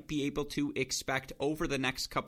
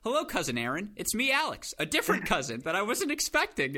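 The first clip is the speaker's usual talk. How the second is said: retold cheeky hello, pitched up and a bit louder; playful teasing greeting; 7 semitones higher, 4 dB louder than usual. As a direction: tease the cousin you just surprised. Teasing greeting to surprised cousin.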